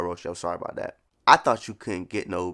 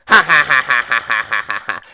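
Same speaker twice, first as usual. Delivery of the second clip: put-on cute girl's laugh; 2 semitones higher, 10 dB louder than usual. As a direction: act out her cute little laugh. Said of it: "I'm thinking her laugh is like".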